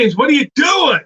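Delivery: yelling his name across the bar; high, loud call-out to a customer; retold shout. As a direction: shout from the door, half-scolding. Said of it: "And I'd be like".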